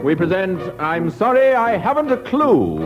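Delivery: ordinary talk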